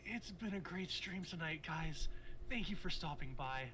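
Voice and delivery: anime voice. Pretend. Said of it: "my anime voice".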